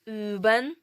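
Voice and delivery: "Üben" loud, with long rounded ü; German ü demo. Shown as a demonstration. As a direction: model "Üben" for learners, rising. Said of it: "Потренуємося на словах".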